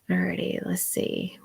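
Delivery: very soft, whispered muttering; barely audible talking to oneself; whispering to self. Real, not acted. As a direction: mutter it under your breath while scanning comments. Reading comments to herself.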